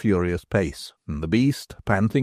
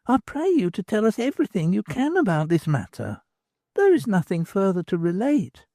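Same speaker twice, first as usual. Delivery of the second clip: higher, earnest woman explaining; a young woman laying out what she realised; Nan's voice; 9 semitones higher than usual. Acted. Women speak in higher voice.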